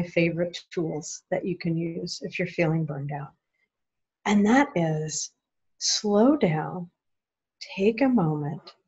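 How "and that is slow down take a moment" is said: usual speaking voice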